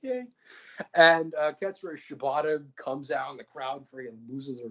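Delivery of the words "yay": high, goofy whiny voice; high, goofy whiny voice of Kazuchika Okada; Okada impression. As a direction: play a needy clown begging for love. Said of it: "Kazuchika Okada comes out and he's like".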